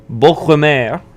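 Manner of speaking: name said in a mock French accent; a playful French accent on the name. Streamer names the hero in a French accent. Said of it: "throw that French accent on there".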